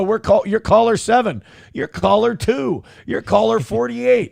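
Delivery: high, upbeat radio host. Retold or imitated. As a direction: rattle off caller numbers like a DJ. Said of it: "we go".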